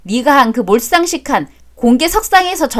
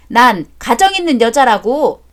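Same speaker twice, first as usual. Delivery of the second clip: ordinary delivery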